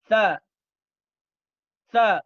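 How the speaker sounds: sa said loud with the tongue between the teeth; Arabic letter sa. Shown as a demonstration. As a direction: hiss sa over the tongue. (sa diucapkan dengan lidah di antara gigi)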